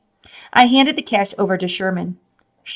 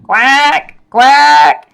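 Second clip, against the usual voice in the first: duck quacks, higher and louder; loud quacking; 7 semitones higher, 8 dB louder than usual. A narrator's reading. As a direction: quack at the top of your lungs. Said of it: "quacking at the top of his lungs".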